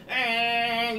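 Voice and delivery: long, high, loud "eeeng" drone; imitated bee buzz. Teacher mimics scout bee touting its spot, high droning buzz. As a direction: mimic a dancing bee with a drawn-out whine.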